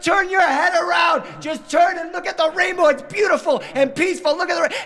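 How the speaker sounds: high, loud, hysterical parent act; frantic shouting. Retold or imitated. Dad to sobbing daughter, high frantic shouting. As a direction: yell directions like a dad at wit's end.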